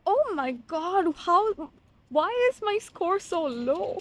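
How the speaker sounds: high, loud, stunned oh my god; real disbelief at a bad grade; shocked dismay. Real, not acted. Student reading exam results, shocked and loud.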